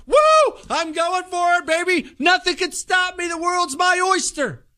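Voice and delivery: high, hyped-up whoop; triumphant whoop. Retold or imitated. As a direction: shout like nothing can stop you. Democrat gloating.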